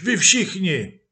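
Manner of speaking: loud, high shout at the demons. Acted. Possessed man shouts the demons away, loud and high. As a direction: yell them out of your life. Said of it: "křičel muž".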